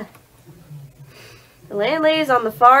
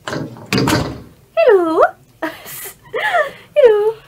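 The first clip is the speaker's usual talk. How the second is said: put-on old lady voice; 9 semitones higher, much slower than usual. Knocking on landlady's door.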